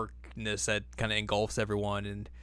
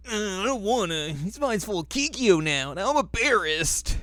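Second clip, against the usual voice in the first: much higher, mock-bashful girly voice; embarrassed girl; 10 semitones higher than usual. Recapping the anime to a friend, Kagome mocked in a much higher bashful voice. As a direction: squeak it out like a flustered schoolgirl. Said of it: "And she's like".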